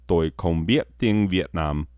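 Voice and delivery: "Tôi không biết tiếng Việt Nam" said in careful Vietnamese tones; Vietnamese phrase. Shown as a demonstration. Comedian demonstrating "Tôi không biết tiếng Việt Nam" in Vietnamese tones. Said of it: "You hear that?".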